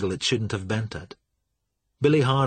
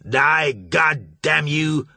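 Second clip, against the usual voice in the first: loud, very slow, higher yell; furious yell; 5 semitones higher, 4 dB louder, much slower than usual. A narrator's reading. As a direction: scream it as you fire. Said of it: "Marsh yelled".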